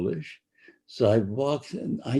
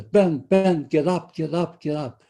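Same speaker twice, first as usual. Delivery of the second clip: higher, louder urgent calling of a name, fading on the last words; urgent wake-up; 6 semitones higher, 4 dB louder than usual. Retold or imitated. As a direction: shake him awake in a hurry. Cousin urgently waking him at night.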